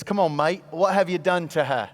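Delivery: put-on Australian police officer voice; playful Australian-accented questioning. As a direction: do a broad Aussie cop leaning in. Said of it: "not to do the Australian accent".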